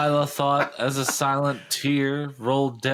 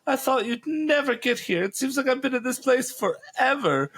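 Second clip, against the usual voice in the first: heroine voice. Acted. Heroine voiced in high pitch.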